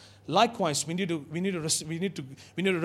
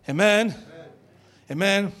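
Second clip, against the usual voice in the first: rousing amen; loud rising amen; 2 semitones higher, 4 dB louder than usual. Real, not acted. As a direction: call out to the crowd. Calls for congregation response.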